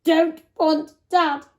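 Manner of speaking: put-on high, loud, slow toddler bellow; bellowing baby. A narrator's reading. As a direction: refuse stubbornly like a cross toddler. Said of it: "bellowed the baby".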